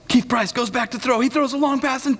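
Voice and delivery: high, excited play-by-play imitation; sports radio commentator. Radio announcer calling a football game, sports commentator imitation. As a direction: call the pass like a radio announcer.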